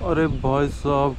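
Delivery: usual speaking voice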